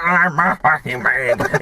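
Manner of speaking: slowed, lightly voiced crackhead groan. Crackhead on the floor grabbing the bag, slowed. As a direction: drag it out like a drunk. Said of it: "he's like".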